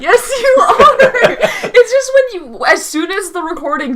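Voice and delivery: high, loud, laughing insistence; exasperated outburst. Real, not acted. Co-host contradicting podcaster, high exasperated cry. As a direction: squeal the contradiction back.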